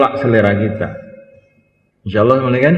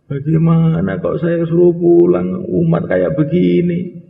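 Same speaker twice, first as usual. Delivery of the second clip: tearful protest; 8 semitones higher, slower than usual. Retold or imitated. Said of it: "Pulang tuh nangis".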